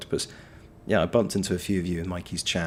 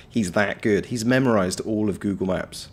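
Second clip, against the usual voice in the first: ordinary talk, nothing marked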